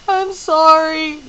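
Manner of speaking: slow, high and loud, through sobs; sobbing. Acted. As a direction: blurt the apology through tears. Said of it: "she sobbed finally".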